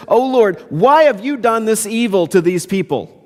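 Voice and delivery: high-pitched mock accusation of God; indignant complaint. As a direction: plead in protest, pitched well up. Moses confronts God in sermon.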